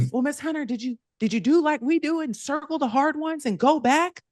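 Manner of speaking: put-on high earnest child voice; imitating kids. Pupil advising Miss Hunter on test tricks, high kid voice.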